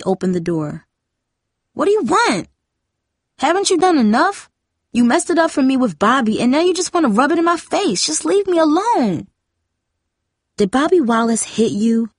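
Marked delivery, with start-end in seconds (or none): 1.7-9.3 put-on high-pitched, upset teen rant; angry outburst. A narrator's reading. Jamie opens the door and blames Darcy, angry outburst. 10.6-12.1 higher-pitched demanding question. A narrator's reading. Darcy questions her sister about Bobby, demanding. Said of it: "Darcy demanded".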